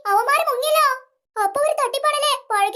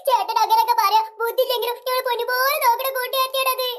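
ordinary delivery

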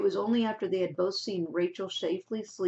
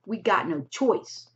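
stubborn; 4 dB louder, slower than usual. A narrator's reading. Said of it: "the man said stubbornly".

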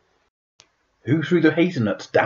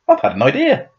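excited; 11 semitones higher, 3 dB louder than usual. Acted. Dog's bark translated, excited.

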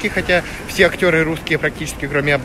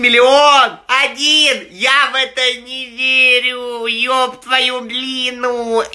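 excited disbelief; 7 semitones higher, much slower than usual. Real, not acted. Mock disbelief about million.

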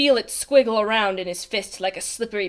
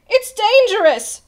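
high, slow, loud warning; pleading; 11 semitones higher, 8 dB louder than usual. A narrator's reading. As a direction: stretch out an urgent plea. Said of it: "pleaded Bartholomew".

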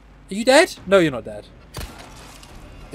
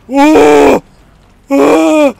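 loud, drawn-out whoa; alarmed yell; 5 semitones higher, 5 dB louder than usual. Real, not acted. Player caught out by the game, alarmed yell. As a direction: cry out long in alarm.

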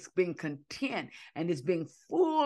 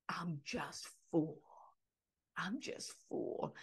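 breathy half-whisper, much softer, slow; overwhelmed, barely able to get it out; mom's quiet reply; 13 dB quieter, slower than usual. Retold or imitated. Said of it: "she would just say".

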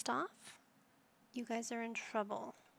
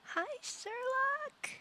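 high, slow sing-song coo to a pet; pet-talk greeting; 4 dB louder, much slower than usual. Real, not acted. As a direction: stretch it out sweetly like greeting a puppy. Cooing at in-game pet.

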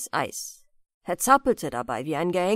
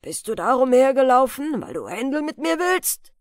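loud, raised-pitch challenge from the farmhand; angry growl; 4 semitones higher, 7 dB louder than usual. A narrator's reading. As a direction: confront him angrily, spoiling for a fight. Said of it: "knurrte der Knecht". (aufgebracht angeknurrt, laut)

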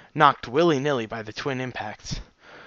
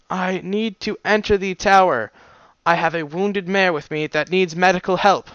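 written message voiced; 6 semitones higher, 4 dB louder than usual.

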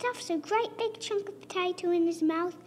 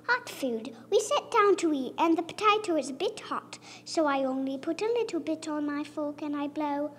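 usual speaking voice

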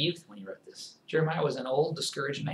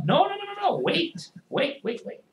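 urgent pleading. Acted. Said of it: "urging restraint".

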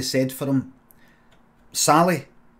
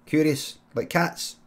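ordinary delivery

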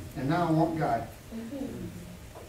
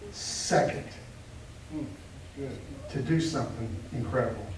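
much softer, "second" breathed out unvoiced; hushed near whisper; 5 semitones lower, 11 dB quieter than usual. Shown as a demonstration. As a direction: drop to a hush to draw them in. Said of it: "hushed repeat".

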